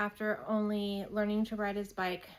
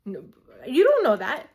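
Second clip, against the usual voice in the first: alarmed objection; 3 semitones higher, 11 dB louder than usual. Retold or imitated. Mom contradicting her husband, alarmed and loud. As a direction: cut him off in alarm.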